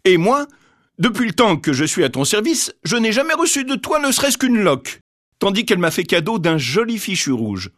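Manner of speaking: high, indignant servant voice; servant complaining. A narrator's reading. Servant reproaching Baba Yaga, high indignant voice. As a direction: grumble about never getting a rag.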